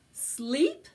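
plain reading voice

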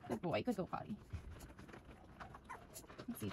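ordinary talk, nothing marked

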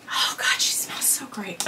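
adoring whisper; hushed breathy whisper. Real, not acted. Smelling the bag.

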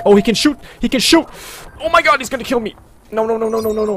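loud, high panicked cry; terrified he'll die; panicked screaming. Real, not acted. Gamer under fire, screaming in panic. Enemy shoots at him.

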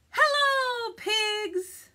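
high, loud storybook duck hailing a friend; calling out a greeting. Acted. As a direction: sing out a big hello as the duck. Duck calls to Pigs in story.

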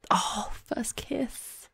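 hushed, slow excited whisper. Real, not acted. Streamer whispering as her sims kiss. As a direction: breathe it out in awe.

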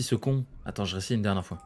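ordinary talk

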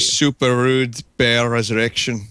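attempted East London gangster accent that slides toward Scottish, slow; a botched East London gangster accent, landing near Scottish; failed East London gangster accent. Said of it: "an East London gangster accent".

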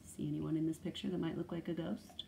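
plain reading voice

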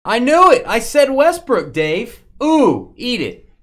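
loud, high triumphant gloating. Real, not acted. Gloating after winning a guess.

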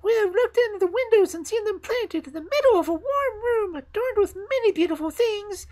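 very high, loud chirpy bird voices; twittering sparrows. Acted. The sparrows boast to the fir tree, very high and chirpy. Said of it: "twittered the sparrows".

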